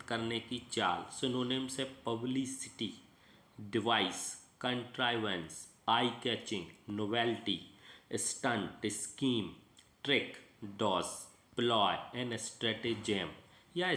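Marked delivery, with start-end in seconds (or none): none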